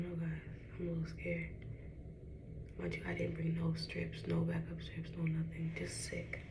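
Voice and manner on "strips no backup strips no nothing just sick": whispered, much softer. Real, not acted. Grumbling to viewers about no backup strips, whispered. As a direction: barely breathe the words.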